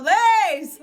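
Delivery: loud, high name call; boisterous mock shout of a nickname. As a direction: imitate dad hollering a greeting. Dad calling to her, retold.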